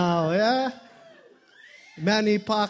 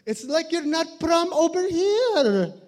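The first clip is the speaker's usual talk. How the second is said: much higher scolding auntie voice; 8 semitones higher than usual. Retold or imitated. Auntie in front row teasing him, high voice. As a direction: tease like a nagging auntie. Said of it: "she's like".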